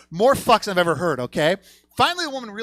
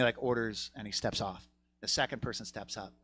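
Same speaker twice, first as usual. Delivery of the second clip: ordinary talk, nothing marked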